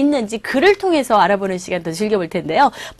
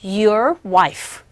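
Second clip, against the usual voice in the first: slow formal model line; much slower than usual. Shown as a demonstration. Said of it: "조금 격식을 차려서".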